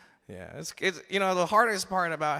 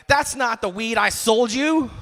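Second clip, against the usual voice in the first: higher, louder, drawn-out scolding; mock accusing mom; 6 semitones higher, 6 dB louder, slower than usual. Mom confronting her son after sniffing him, mock outrage. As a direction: play an indignant mother, hammed up. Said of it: "she's all like".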